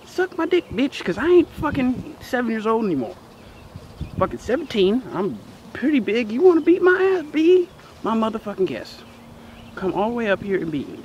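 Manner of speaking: high-pitched cocky challenge; defiant taunt. Real, not acted. Grown pupil calls out old teacher on camera, defiant. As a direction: dare them to come and fight.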